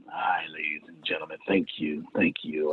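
soft, low preacher greeting; hushed churchy voice. Pretend. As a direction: hush it like a pastor at the pulpit. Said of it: "I gotta put my preacher voice on".

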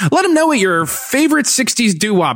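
imitating a perky, high-pitched church announcer; announcements guy. Announcements guy asking churchgoers to greet each other, mock-perky high voice. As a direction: chirp it out like a mock service host. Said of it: "the announcements guy's, like, up there".

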